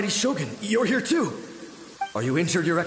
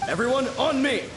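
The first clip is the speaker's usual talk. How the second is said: higher, ringing order to form up; battle command; 7 semitones higher than usual. Acted. Samurai commander ordering his troops to gather, ringing shout. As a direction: call the men to your side.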